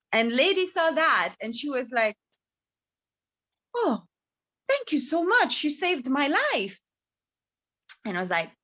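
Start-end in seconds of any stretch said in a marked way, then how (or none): 3.8-6.8 higher-pitched, lightly acted relieved thanks; grateful damsel. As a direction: play a rescued lady thanking her saviour. Rescued lady thanks her saviour.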